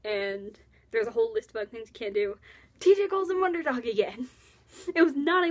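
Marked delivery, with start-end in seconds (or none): 2.8-5.5 higher and louder, thrilled; excited. Real, not acted. Fan gushing to viewers about the nickname, excited. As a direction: burst out with delight at the nickname.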